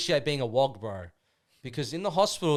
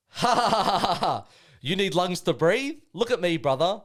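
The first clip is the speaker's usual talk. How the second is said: higher, louder cackling taunt; mocking head voices; 7 semitones higher, 8 dB louder than usual. Voicing the taunting voices in his head with a higher, louder mocking laugh. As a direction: laugh at him like a gleeful tormentor. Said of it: "So now I'm going into, like, their voice".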